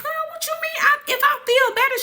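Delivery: put-on high-pitched girl-what-you-mean; incredulous protest.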